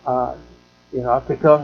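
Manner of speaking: usual speaking voice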